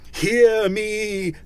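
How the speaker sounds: loud, high, ghostly call; commanding cry. A narrator's reading. Ghost demanding Scrooge listen, crying out. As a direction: cry out for attention. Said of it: "cried the ghost".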